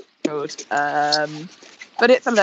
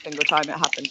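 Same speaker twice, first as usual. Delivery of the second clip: ordinary talk, nothing marked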